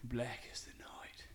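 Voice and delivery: slow, very soft whisper; hushed whisper. Acted. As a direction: whisper it slowly, drawn out.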